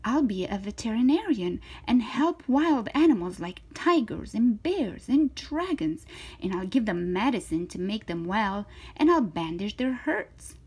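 lively, swooping story read; reading to children. Acted. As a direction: voice an eager, confident little girl. Reads to children, voicing Zoe.

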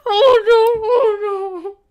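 high, whimpering dread; real distressed whine; dismayed wail. Real, not acted. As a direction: wail in dismay at what is coming. Dreading a sad trailer scene.

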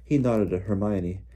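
plain reading voice

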